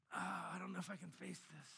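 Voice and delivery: soft, sighing mock discouragement; deflated. Preacher voicing us facing a battle, deflated. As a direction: act out feeling down, quiet and defeated. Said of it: "We just kinda get down".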